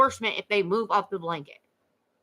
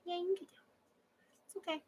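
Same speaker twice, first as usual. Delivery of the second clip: high, soft reassurance to a dog; gentle pet talk; 10 semitones higher, 9 dB quieter, much faster than usual. Real, not acted. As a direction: coax the dog kindly, up high. Soothing dog on her lap.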